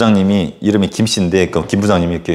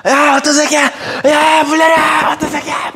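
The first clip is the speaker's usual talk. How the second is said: rowdy shouting. Retold or imitated. Students yelling at the stall.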